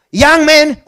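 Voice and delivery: loud, high call across the garden. Retold or imitated. Dean calls out loudly to the crying young man. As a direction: dean hailing the crying student.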